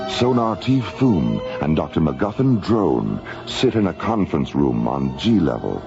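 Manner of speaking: normal talking voice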